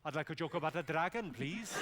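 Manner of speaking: lilting Welsh request; Welsh accent. Retold or imitated. Man in Cardiff audience requesting a joke, lilting Welsh accent. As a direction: ask as an expectant Welsh heckler.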